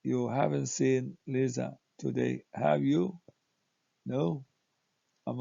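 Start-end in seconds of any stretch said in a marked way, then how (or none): 0.0-3.2 "have you?" lifts at the end as a real question; rising question tag. Shown as a demonstration. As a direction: ask sincerely, raising the voice on "have you?". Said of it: "if the voice goes up".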